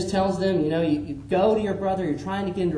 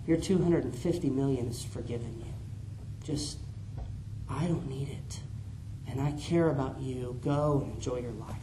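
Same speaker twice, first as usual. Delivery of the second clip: softer, gentle forgiving voice; tender master forgiving; 4 semitones lower, 9 dB quieter than usual. Acted. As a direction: let him off kindly, almost hushed.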